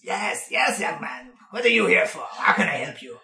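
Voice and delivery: put-on wheezy old gnome-dwarf greeting a customer; creaky ancient bookseller in a vague accent; gnarled old shopkeeper. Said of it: "it takes notice of you. He says".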